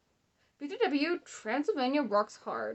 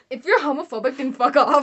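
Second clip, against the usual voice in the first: loud, higher fuck off to viewers; loud rant; 3 semitones higher, 11 dB louder than usual. Real, not acted. Telling homophobic viewers to leave, a loud rant. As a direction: hurl it at the haters. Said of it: "Guys, I have a message for you".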